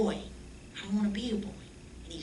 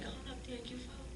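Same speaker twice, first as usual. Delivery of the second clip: much softer, higher hushed nudge; hushed urging; 8 semitones higher, 15 dB quieter than usual. Retold or imitated. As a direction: quietly push your friend to go up in church. Urging friend during service.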